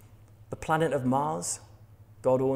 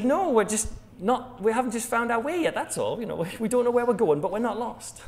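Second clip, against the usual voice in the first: higher, mock indignant insistence; a stubborn driver refusing to admit it; defensive denial; 7 semitones higher than usual. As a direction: driver huffily insisting to partner they're not lost. Driver denies being lost.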